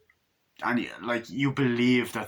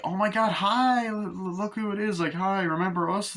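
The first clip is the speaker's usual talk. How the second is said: much higher, bubbly over-the-top hello; gushing greeting; 10 semitones higher than usual. Acted. Friend on the beach greeting the couple, gushing high. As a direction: squeal a fake-friendly welcome.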